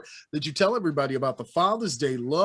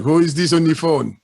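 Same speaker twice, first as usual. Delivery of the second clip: caller voice; 8 dB louder than usual. Retold or imitated. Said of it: "and be like".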